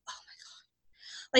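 hushed, breathed-out exclamation; retold relief. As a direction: let it out slowly on a soft breath. Family retold finally driving away.

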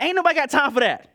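quick, sassy quote of the famous clip; mock viral-video quote. Quoting the meme about sin, quick and sassy. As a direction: fire it off like the meme. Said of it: "I'm like".

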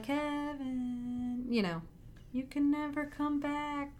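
soft, airy ghost calling from beyond; a faint, far-off spirit voice; ghostly voice. Retold or imitated. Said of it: "all that's left is her ghostly voice".

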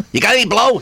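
high-pitched mimicked stripper; stripper's stock answer. Stripper asked where she is from, high mimicked stock answer.

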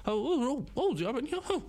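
mock surprise. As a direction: ham up your own dumb amazement. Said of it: "Ich hab eine ernste Frage gestellt, so".

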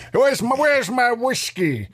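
high, drawn-out comic holler without extra volume; slow, high-pitched mock plea; mock drink demand. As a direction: play-act calling for your booze.

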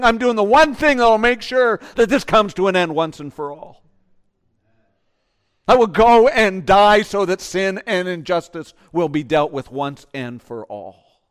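loud, firm declaration; a hint of Jesus vowing to finish it; resolute Jesus. Jesus answering the weeping women, loud resolute vow. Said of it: "And Jesus saying".

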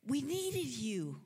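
high, drawn-out anguished reproach; Mary. Acted. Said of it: "getting a little more excited".

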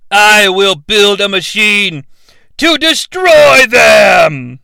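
slow, rising to a shout; building villain threat. Acted. Enchantress to Incubus, a slow threat swelling into a shout. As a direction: swell into a grand evil vow.

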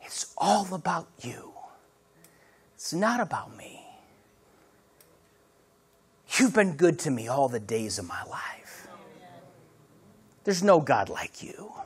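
soft, low, reverent praise with long pauses; hushed worship. Acted. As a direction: murmur it to God from the heart. Singing from the heart in worship.

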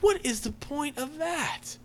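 high, slow, drawn-out incredulous question; exasperated disbelief. Retold or imitated. Reviewer relives her reaction to the milk bath, exasperated disbelief. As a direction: squeal it slowly in baffled protest.

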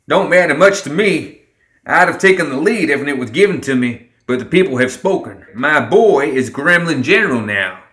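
proud father declaring to all; Summer, proud. A narrator's reading.

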